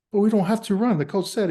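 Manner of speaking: high, indignant protest; surprised protest. Retold or imitated. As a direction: protest that the coach said no running.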